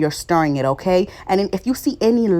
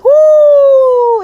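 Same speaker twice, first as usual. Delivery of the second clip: much higher, much louder triumphant woo; excited whoop; 10 dB louder than usual. Real, not acted. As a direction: let out a big celebratory whoop. Rice turned out perfect.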